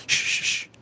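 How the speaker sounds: unvoiced breathy shush. Streamer shushes chat during a pretend secret, unvoiced shush. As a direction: hush everyone like it's a secret. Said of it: "Nobody's hearing us".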